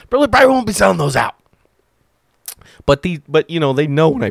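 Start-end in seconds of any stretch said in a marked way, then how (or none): 0.1-1.3 high, loud, fast words through laughter; rushed squeal on the tail of a laugh. Real, not acted. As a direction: push the line out while cracking up. Still laughing about not selling out.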